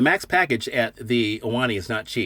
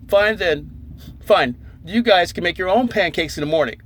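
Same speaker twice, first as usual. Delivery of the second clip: sulky huff; 6 semitones higher than usual. Retold or imitated. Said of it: "And I'm all just".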